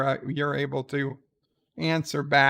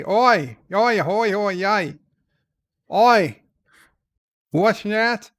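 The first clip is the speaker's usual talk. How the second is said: loud, hammed-up Australian cousin; Ozzy O'Prey; 3 semitones higher, 5 dB louder than usual. Australian cousin answering the host's call, in a put-on Australian accent. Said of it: "I'm the one that's Australian".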